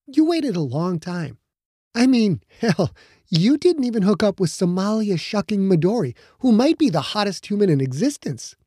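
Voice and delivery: a hint of a teasing girlfriend; Becca's voice. Becca gently teasing her boyfriend about his guilt, lightly voiced.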